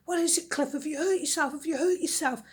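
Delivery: worried sisters. Acted.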